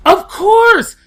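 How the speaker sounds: high, loud exasperated shout; retold yelling. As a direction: yell back as if it's obvious. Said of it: "I did yell at her a little bit".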